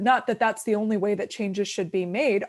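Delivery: ordinary talk, nothing marked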